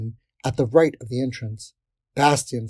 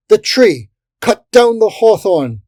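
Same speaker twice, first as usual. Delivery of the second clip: high, urgent shouted order; shouting; 9 semitones higher, 9 dB louder than usual. A narrator's reading. Captain ordering the hawthorn cut down, shouting. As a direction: order the troops at full voice. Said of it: "the captain shouted".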